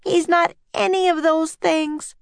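high indignant yell. A narrator's reading. Annie defending the octopus against the crowd, yelling. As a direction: deny it in a high girl's voice. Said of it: "yelled Annie".

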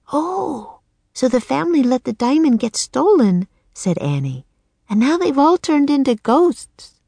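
put-on high, bright young girl realising; excited girl voice. Annie realises the truth.

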